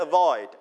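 Avoid with a light a- and a strong, falling void; stress on second syllable. Shown as a demonstration. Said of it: "เน้นที่พยางค์ที่สองครับว่า void".

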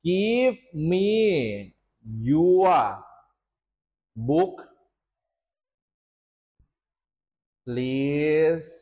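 very slow, long pauses between words; drawn-out words, one at a time; word-by-word dictation. Shown as a demonstration. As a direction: say it while writing it out. Teacher writes out the answer word by word.

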